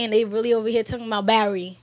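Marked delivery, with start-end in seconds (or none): 1.3-1.8 BARRY said as battery squeezed to two syllables; Philly pronunciation. Retold or imitated. New Yorker mimicking a Philly friend, battery as BARRY. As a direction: say battery as BARRY, dropping the middle t. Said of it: "who's from Philly".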